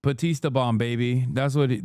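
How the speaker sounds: ordinary talk, nothing marked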